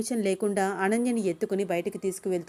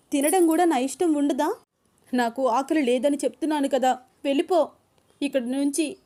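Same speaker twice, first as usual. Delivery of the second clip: higher, louder exasperated outburst; angry and tearful; 7 semitones higher, 5 dB louder than usual. A narrator's reading. Wife shouting at her husband to leave her alone, angry and tearful. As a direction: snap at him through angry tears. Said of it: "విసుక్కుంటూ ... ఉక్రోషంగా".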